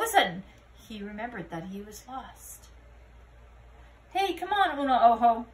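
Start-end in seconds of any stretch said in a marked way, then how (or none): 4.1-5.5 louder call to his friend. Acted. Scapito calling his new friend to come along. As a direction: call him over. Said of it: "called the kitty boy".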